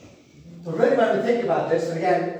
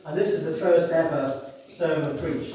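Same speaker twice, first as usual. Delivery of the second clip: normal talking voice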